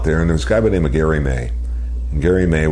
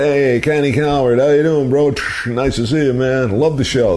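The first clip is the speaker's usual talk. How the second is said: much higher, upbeat greeting; excited ushers; 10 semitones higher than usual. Retold or imitated. Ushers greeting the radio host, excited and high. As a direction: hype him up like a fan spotting a star.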